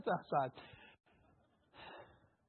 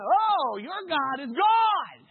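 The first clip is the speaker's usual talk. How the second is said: awed crowd; 6 semitones higher, 8 dB louder, much slower than usual. Retold or imitated. Crowd voiced, awed exclamation.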